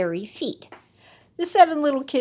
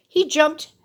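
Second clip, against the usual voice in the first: ordinary delivery, nothing marked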